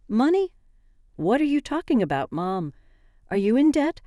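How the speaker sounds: rising in disbelief, voice jumping up; shocked confrontation. A narrator's reading.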